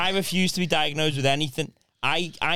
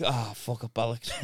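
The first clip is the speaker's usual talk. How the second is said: a low mumbled oh fuck up; muttered low curse; 8 semitones lower, 7 dB quieter than usual. Retold or imitated. Caught out over the forgotten milk, low mumbled curse. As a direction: drop low and soft, cursing under your breath.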